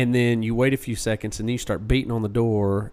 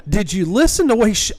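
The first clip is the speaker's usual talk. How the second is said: exasperated; 10 semitones higher, 5 dB louder than usual. Retold or imitated. Retold exasperated reply to Brett.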